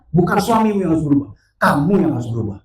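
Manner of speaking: loud, forceful repeated answer; voice of God, insistent. Retold or imitated. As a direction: drive the verdict home, pitch falling. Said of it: "Tuhan tetap jawab yang sama". (jawaban Tuhan yang lantang dan tegas)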